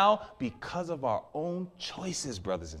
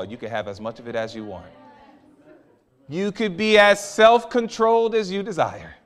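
normal talking voice

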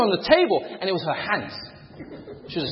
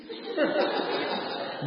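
breathy laugh; 9 semitones higher, 9 dB quieter than usual. Real, not acted. Realizes he is the vibrating one.

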